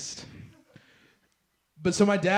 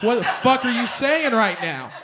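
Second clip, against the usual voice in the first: outraged yell; 6 semitones higher, 6 dB louder than usual. Retold or imitated. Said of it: "I was like".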